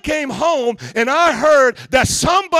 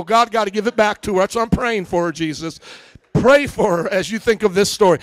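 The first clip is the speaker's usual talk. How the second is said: ordinary delivery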